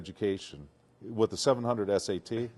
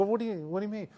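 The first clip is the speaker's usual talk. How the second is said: puzzled; 13 semitones higher, faster than usual. Retold or imitated. Son's puzzled reply in retold argument.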